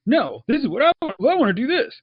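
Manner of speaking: high-pitched, swooping mock tantrum; mock stubborn insistence. Imagined director in a tug of war, mock stubborn. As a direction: insist childishly, pitch jumping way up.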